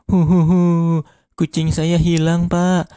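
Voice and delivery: hammed-up high sobbing; mock tearful whine; crying. A narrator's reading. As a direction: wail like a child who lost something. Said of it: "Mamat yang sedang nangis".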